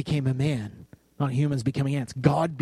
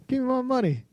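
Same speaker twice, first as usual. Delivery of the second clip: high, pushy demand, pitched way up; hammed-up greedy demand; mock demand; 10 semitones higher, faster than usual. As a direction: pretend to demand the cash. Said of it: "I didn't do that".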